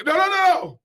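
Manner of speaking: high, loud string of no's; mock alarmed protest. Stopping someone sipping from a tuned water jug, mock alarmed protest.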